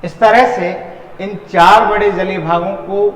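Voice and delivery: usual speaking voice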